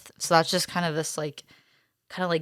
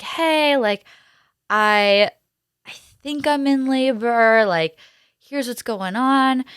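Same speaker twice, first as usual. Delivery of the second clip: higher, drawn-out mock-casual voice; tentative retold announcement; 6 semitones higher, 5 dB louder, slower than usual. Telling her doula she thinks it's labor, tentative. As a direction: retell it pitched up, stretching the words. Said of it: "I text my doula, and I was like".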